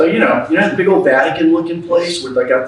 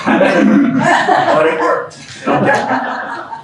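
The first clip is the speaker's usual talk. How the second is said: real laughter, pitched way up and drawn out; laughing; 14 semitones higher, 3 dB louder, much slower than usual. Real, not acted. Speaker telling the crowd his trick worked, laughing. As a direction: laugh through the words, very high and slow.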